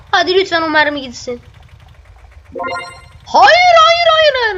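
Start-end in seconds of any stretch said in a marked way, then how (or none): 3.2-4.6 high, loud panicked pleading. Real, not acted. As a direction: beg the bullet to miss. Bullet about to hit him.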